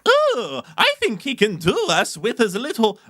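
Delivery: high, goofy clown voice; hammy old-time circus clown; silly clown. Acted. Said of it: "nineteen twenties circus clown".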